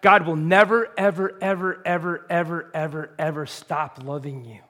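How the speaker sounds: each ever hammered in a long build, fading at the end; stretched repetition. Real, not acted. Preacher telling the church, the repeated ever stressed. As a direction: pound every ever, then let it soften.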